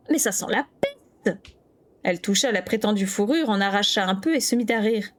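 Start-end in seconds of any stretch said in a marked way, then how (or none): 0.1-1.4 slow, nose-wrinkled disgust. A narrator's reading. Kira smells the fur, mildly disgusted. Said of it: "fronçant le nez".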